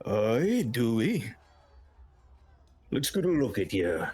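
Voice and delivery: battle-weary orc voice; put-on orc character; grizzled orc. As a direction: size up a newcomer as a veteran orc. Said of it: "This grizzled, battle-weary orc".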